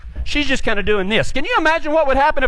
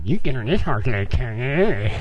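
low, garbled mock muttering; mocking grumble; 12 semitones lower than usual. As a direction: grumble back low and sulky. Grumbling back at instructor.

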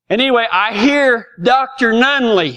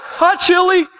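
high hi through a laugh; 10 semitones higher, slower than usual. Retold or imitated. Boy greeting the carpenter, high and laughing. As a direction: squeak it out while laughing.

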